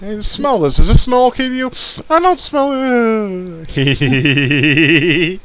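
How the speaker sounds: high, mock-sweet creep; creepy kidnapper voice. Acting creep with chloroform rag.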